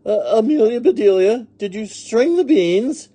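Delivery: high, loud anxious question; Missus Rogers asking. A narrator's reading. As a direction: call the question out to the maid. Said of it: "asked Missus Rogers".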